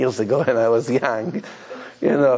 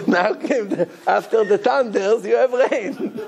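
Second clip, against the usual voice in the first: laughing retelling; 6 semitones higher than usual. Retold or imitated. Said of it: "He screamed".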